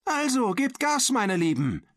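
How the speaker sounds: very high, urging teacher voice; calling out. A narrator's reading. Teacher spurring on her dear pupils, calling out. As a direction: spur the team on in a high call. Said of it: "rief Maria Dolores jetzt".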